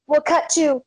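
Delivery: normal talking voice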